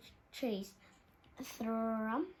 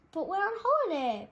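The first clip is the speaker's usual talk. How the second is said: protesting; 8 semitones higher, 7 dB louder than usual. A narrator's reading. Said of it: "protested the wolves".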